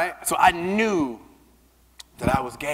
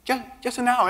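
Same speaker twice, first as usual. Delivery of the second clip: higher, wheedling plea; coaxing plea; 7 semitones higher than usual. Retold or imitated. Asking Nicole to visit, higher wheedling plea. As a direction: coax her sweetly into coming. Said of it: "I'm like".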